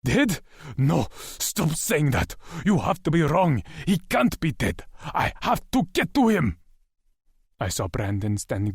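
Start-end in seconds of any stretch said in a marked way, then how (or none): 0.0-6.6 frantic denial. A narrator's reading.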